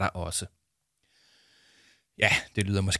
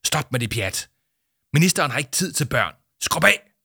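loud, rising angry order; 4 semitones higher, 5 dB louder than usual. Acted. School leader scolding the children, rising to a shout. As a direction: chase the kids off sharply.

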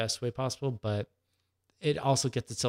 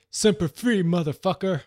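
shouted movie-hero catchphrase, much higher and louder; Dwayne Johnson impression; 7 semitones higher, 8 dB louder than usual. Quoting the movie's star for the hosts, a loud hammed-up hero shout. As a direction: bellow it like a tough Marine action star. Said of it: "As, as Dwayne Johnson would say".

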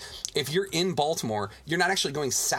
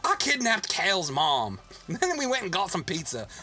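high-pitched, hammed-up Baltimore accent; silly put-on Baltimore local; Baltimore character voice; 5 semitones higher than usual. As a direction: brag in an exaggerated Baltimore accent. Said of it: "we've created, like, this character".

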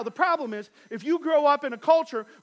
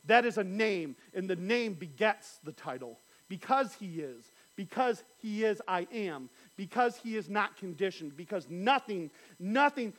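ordinary talk, nothing marked

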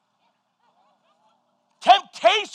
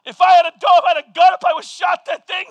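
mock-angry rant; 4 semitones higher, 7 dB louder, faster than usual. Ranting about the stray dog.

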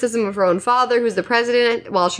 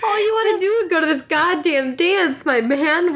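laughing exasperation; 6 semitones higher than usual. Real, not acted.

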